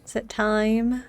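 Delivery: ordinary talk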